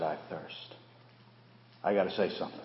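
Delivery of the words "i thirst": near-breathless quiet; hushed. Acted. Jesus dying on the cross, faint and quiet. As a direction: let the words barely leave the lips.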